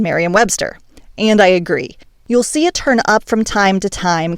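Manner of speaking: normal talking voice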